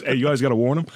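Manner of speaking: ordinary talk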